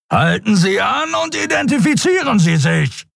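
roaring captain. A narrator's reading. Captain roars his final warning at Endriel. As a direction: roar it at the fleeing ship.

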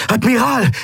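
high, urgent hail; calling out. A narrator's reading. Kai hailing the admiral at the tower, high urgent shout. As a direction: call the commander over. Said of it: "rief er".

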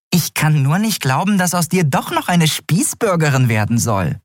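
high-pitched, mock-disbelieving companion; high, pert disbelief; teasing Nelen. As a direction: tease a friend in a high voice.

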